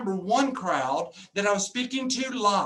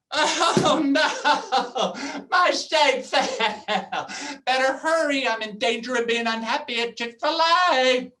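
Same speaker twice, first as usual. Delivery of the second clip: higher, slow mock panic over a fallen shake; mock dismay; 4 semitones higher, 4 dB louder, slower than usual. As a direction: ham up fake alarm, stretching my shake fell. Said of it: "I'm like".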